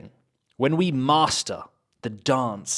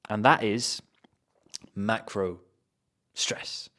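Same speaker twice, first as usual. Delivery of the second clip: usual speaking voice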